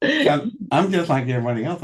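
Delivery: talking through real laughter; giggling out the words; laughing. Real, not acted. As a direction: laugh as you speak. Laughing after the towel moment.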